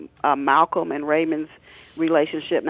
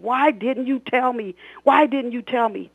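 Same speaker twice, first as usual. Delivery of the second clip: angry father; 8 semitones higher, 3 dB louder than usual. Acted. Drunk father confronting son.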